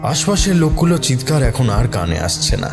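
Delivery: ordinary delivery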